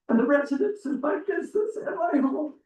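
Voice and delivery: choking up, words fading to breath; voice breaking. Real, not acted. Resident breaking down while reading her letter to council, voice cracking into tears. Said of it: "I know it's not professional, but I can't do it".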